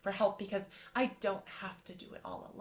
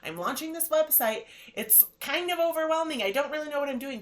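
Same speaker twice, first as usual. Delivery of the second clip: higher, louder flustered self-talk; overwhelmed; 6 semitones higher, 6 dB louder than usual. Retold or imitated. Picturing the huge website launch, overwhelmed. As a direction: act out getting swamped by the big picture. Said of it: "big picture that's overwhelming".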